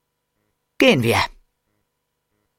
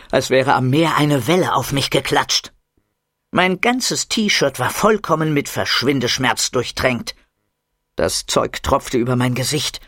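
ordinary delivery, nothing marked

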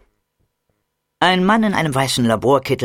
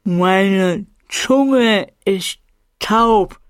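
very slow, carefully spaced words; overly exact; 7 semitones higher, 3 dB louder, much slower than usual. Acted. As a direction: force each word out precisely. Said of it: "sprach dabei so exakt, wie ich konnte". (Ich spreche überdeutlich mit tauber Zunge, sehr langsam)

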